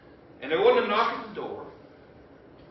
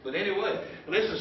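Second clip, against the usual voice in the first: ordinary talk, nothing marked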